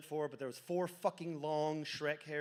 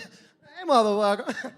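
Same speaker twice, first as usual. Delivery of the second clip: high yelp then a loud bark of a greeting; hyped greeting; 5 semitones higher, 12 dB louder than usual. Retold or imitated. Stoned guy to his friend on the couch, loud excited greeting. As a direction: greet your buddy like a stoned hype man.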